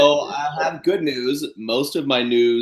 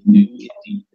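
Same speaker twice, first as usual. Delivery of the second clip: lifted, louder hammed-up repeat; a playful announcer echo; mock grand voice; 6 semitones higher, 5 dB louder, faster than usual. Repeats answer in put-on voice.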